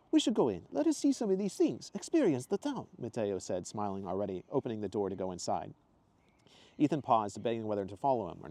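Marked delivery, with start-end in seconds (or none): 0.1-2.9 excited tourist in a put-on accent; Mateo's accent. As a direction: put on Mateo's foreign lilt.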